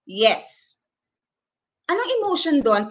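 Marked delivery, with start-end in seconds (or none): 0.1-0.8 low "yes" that falls, softer; a falling, not-happy "yes"; falling intonation. Shown as a demonstration. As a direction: let "yes" drop in tone. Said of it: "when if it's falling".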